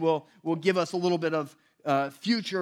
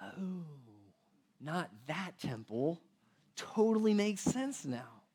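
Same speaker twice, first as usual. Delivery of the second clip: dawning realization; 3 semitones lower, 8 dB quieter, slower than usual. Retold or imitated. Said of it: "the disciples are like".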